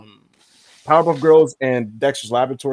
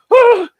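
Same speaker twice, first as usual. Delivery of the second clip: high, loud mock shriek of outrage; a shrill cartoon scream; 9 dB louder than usual. Friend imitates Fred Fredberger screaming over his yogurt, high and loud. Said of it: "he was like".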